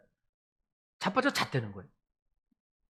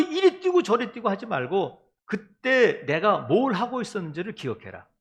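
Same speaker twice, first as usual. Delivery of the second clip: usual speaking voice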